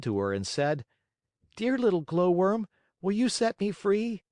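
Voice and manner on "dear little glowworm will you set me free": put-on high, louder puppet-boy voice; small boy begging in a high voice; pleading Pinocchio. A narrator's reading. Trapped marionette calls to a tiny glowworm, high pleading.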